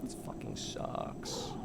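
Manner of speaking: slow, breathless whisper under the breath; whispered curse. Real, not acted. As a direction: whisper it so the monster won't hear. Whispered gripe at scary game.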